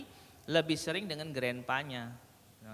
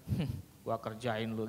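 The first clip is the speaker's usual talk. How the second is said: scheming smirk; 5 semitones lower, 4 dB quieter than usual. Shown as a demonstration.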